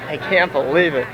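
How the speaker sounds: ordinary delivery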